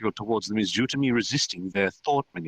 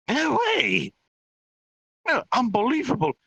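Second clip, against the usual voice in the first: high, stunned exclamation; shocked disbelief; 3 dB louder than usual. Acted. Chaotic telepathic reaction to the trump card, shocked disbelief. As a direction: cry out in disbelief.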